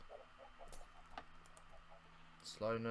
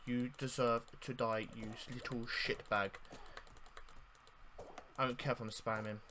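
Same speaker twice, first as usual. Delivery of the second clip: ordinary talk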